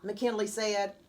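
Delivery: ordinary delivery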